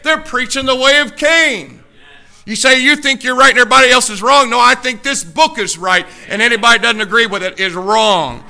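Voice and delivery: loud, slowing preacher's cry; full-force preaching. Real, not acted. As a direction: drive it home from the pulpit.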